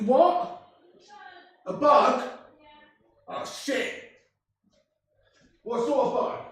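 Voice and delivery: high, distant, incredulous questions; off-mic questioning. Real, not acted. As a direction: ask someone across the room. Away from mic, asking about scream.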